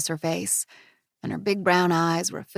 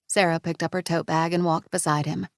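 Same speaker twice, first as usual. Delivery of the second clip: usual speaking voice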